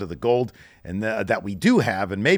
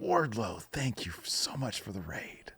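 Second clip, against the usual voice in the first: gasp, then a breathy whispered thank-you; 2 semitones lower, 12 dB quieter than usual. Real, not acted. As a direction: whisper the thanks close to the mic. Thanking a viewer for the raid.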